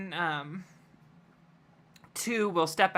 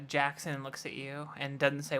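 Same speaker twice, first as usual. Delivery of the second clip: usual speaking voice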